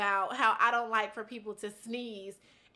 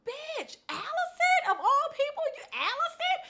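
much higher, squealing through laughter; laughing disbelief. Retold or imitated. Retelling who sneezed, high laughing disbelief. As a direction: crack up in disbelief at who it was.

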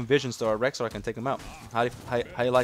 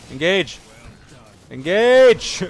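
each Engage louder and higher, ending in a yell; building to a shout; 7 semitones higher, 12 dB louder, much slower than usual. Real, not acted. Pleading with teammates to engage, rising to a shout. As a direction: escalate until you're yelling it.

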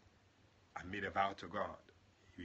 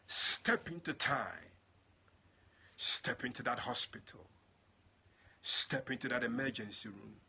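louder, slow, commanding preach; forceful preaching; 3 semitones higher, 7 dB louder, slower than usual. Acted. Preacher commanding God to step into the hospital, loud preaching. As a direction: proclaim each command with force.

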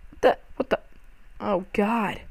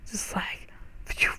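whispered aside; breathy hushed retelling; 12 dB quieter than usual. Retold or imitated. Retelling a fling through air.

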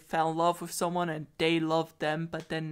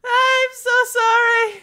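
much higher, slow, tearful heroine; scared heroine; 10 dB louder, slower than usual. Acted. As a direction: apologize while trembling with fear. Heroine apologizing in fear.

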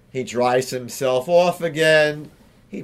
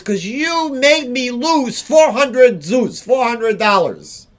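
slow, loud, higher grievance; angry complaint; 6 semitones higher, 3 dB louder than usual. Acted. Bitter man blames Hillel for his lost bet, slow and loud. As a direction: spell out the loss resentfully.

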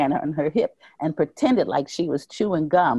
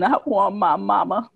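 put-on crying child plea; 4 semitones higher than usual. A narrator's reading. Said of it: "I start crying".

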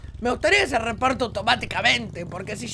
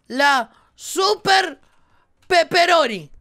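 slow, louder shouted name reveal; announcer shout; 4 semitones higher, 6 dB louder, much slower than usual. Pretend. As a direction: bellow the name like a showman. (grito de presentador, lento)

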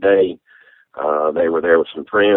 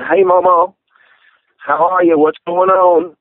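raised, much higher phone voice; speaking up, retold; 11 semitones higher than usual. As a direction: reenact talking to someone hard of hearing. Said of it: "she can't hear".

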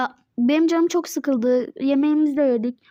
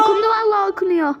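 high, fast, loud cry of relief; shouting that the call is on; excited shout; 7 semitones higher, 4 dB louder, faster than usual. Real, not acted. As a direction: blurt it out with joy. Excited about prayer call.

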